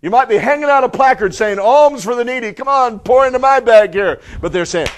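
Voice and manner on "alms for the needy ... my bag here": loud, high-pitched plea for alms; imitating an insistent beggar; beggar calling out. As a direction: hawk for coins across a crowd.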